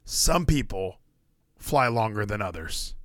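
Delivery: slow, hushed inspirational reading; soft, slow recital of a famous quote; solemn quote. Pretend. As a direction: read it like a motivational poster. Dramatic reading of Jordan quote.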